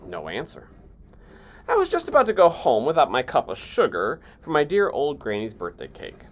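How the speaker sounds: ordinary delivery, nothing marked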